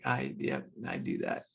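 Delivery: sheepish whispered confession; whispered admission. Pretend. Admitting to yourself, a sheepish whisper. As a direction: own up under your breath.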